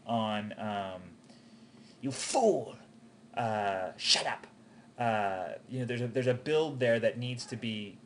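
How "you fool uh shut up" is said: something big. Shown as a demonstration. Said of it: "it has to be something big".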